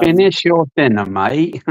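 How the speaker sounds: bad English accent, a bit Australian; poor English accent. Shown as a demonstration. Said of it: "a really poor English accent".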